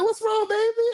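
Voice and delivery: sweet concern. Retold or imitated. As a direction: soothe her gently. Comforting upset Barbie.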